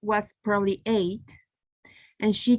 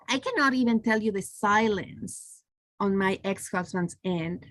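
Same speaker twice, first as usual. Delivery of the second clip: usual speaking voice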